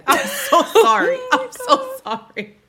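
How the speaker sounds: high, loud apology through laughter; helplessly laughing while saying sorry. Real, not acted. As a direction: crack up mid-apology, pitch leaping up. Amused, laughing apology to the others.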